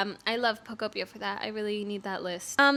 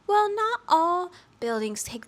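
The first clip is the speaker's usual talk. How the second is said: slow, higher, carefully measured quote; careful hedging; 7 semitones higher, 5 dB louder than usual. Retold or imitated. Imitating how she broke news of building times, careful hedging. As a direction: choose each word with great care. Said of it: "she was very careful how she said that".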